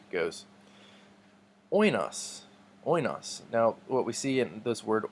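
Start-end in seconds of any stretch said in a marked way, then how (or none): 1.7-3.4 "Oinos" as OY-nos, weight on OY; first-syllable stress. Shown as a demonstration. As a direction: punch the opening diphthong of "Oinos". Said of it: "emphasis goes on the first syllable".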